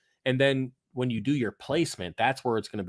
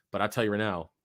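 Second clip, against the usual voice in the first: usual speaking voice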